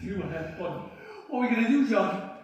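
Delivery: frantic, asking for a plan; panicking. Acted. Trapped man asking John what to do, panicking.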